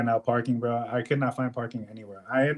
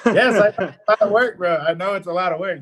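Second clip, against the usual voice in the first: higher and louder, fed up; exasperated reply; 7 semitones higher, 7 dB louder than usual. Retold or imitated. Mover answering the helper who wants to quit, exasperated. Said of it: "I'm like".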